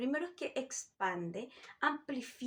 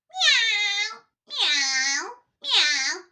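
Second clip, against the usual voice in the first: loud, high whiny meows; twang meow drill; 8 semitones higher, 11 dB louder, much slower than usual. Shown as a demonstration. Teacher leading a twang meow exercise, loud. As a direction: meow through the nose. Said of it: "Se practica de la siguiente forma".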